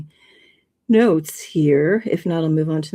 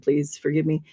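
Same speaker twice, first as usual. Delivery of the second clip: usual speaking voice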